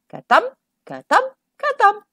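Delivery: high, bouncy thumping chant; rhythmic thump sounds. A narrator's reading. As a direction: beat out each ka-thump.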